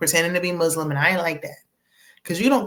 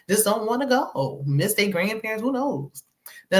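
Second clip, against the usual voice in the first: ordinary delivery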